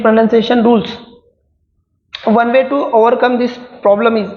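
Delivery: slow, deliberate dictation reading; reading exam options. Acted. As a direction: read each word out slowly for students to follow. Reads next question aloud, slowly.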